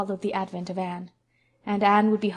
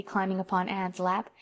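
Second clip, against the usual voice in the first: usual speaking voice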